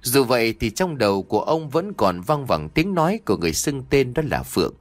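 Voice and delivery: ordinary delivery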